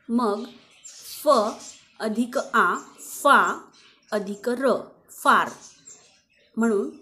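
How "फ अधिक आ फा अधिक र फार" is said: far built from f, long aa and r; sounding out far. Shown as a demonstration. Teacher showing how far is pronounced, sounding it out. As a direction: blend far piece by piece with pauses. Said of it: "असा त्याचा उच्चार होतो".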